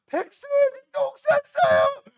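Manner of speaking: very high, slow tattling wail; hammed-up sobbing schoolgirl telling the teacher; mock crying. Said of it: "막 울어". (울며 이르는 여학생을 과장되게 흉내)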